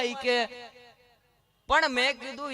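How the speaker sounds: ordinary delivery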